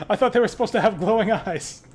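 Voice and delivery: loud high rattled protest; shaken outburst. Real, not acted. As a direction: blurt it out still reeling from the scare. Right after jump scare.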